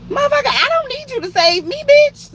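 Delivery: high, loud sassy clapback; sassy outburst. Retold or imitated. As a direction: snap back at him, way up high. Inner retort to trucker, mock outrage.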